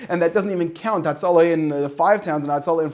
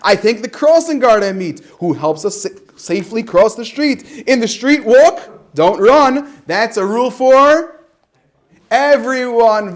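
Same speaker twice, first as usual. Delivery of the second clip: loud, slow storybook read; reading to children; 4 semitones higher, 8 dB louder than usual. Acted. Reading the crossing guard rhyme aloud, loud and slow. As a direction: drag out the rhyme for little ones.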